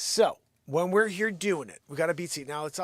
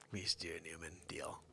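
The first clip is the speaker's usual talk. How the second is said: whispering; 12 semitones lower, 17 dB quieter, faster than usual. Real, not acted. Unvoiced aside.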